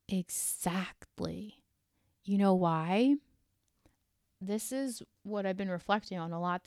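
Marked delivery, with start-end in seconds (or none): 0.1-1.6 slow breathy whisper. Acted. To the listener, a slow confiding whisper. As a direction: hush the word down to a whisper.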